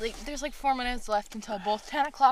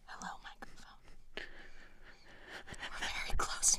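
whispering; 6 dB quieter than usual. Pretend. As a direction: breathe it right into the mic. Leaning into mic, whispering.

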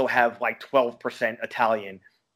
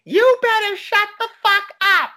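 put-on very high, loud squeaky girl voice; mock girl yelling; 6 dB louder than usual. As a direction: shriek it like the girlfriend. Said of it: "some girl behind him saying".

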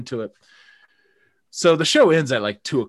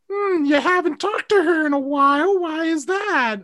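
much higher, put-on suspicious mother; prying mother in falsetto; mock mom voice; 5 dB louder than usual. Mom asking about the girl, high mock mother voice. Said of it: "my mom's like".

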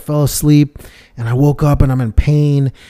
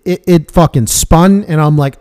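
ordinary talk, nothing marked

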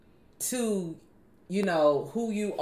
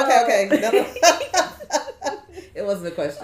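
laughing; 3 semitones higher, 8 dB louder than usual. Real, not acted. Still laughing at the mix-up.

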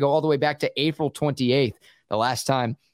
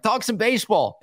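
a much higher, pleading quote; eager listener quote; 8 semitones higher than usual. Retold or imitated. Host lightly voicing a Boston listener asking for baseball talk. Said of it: "and he's like".

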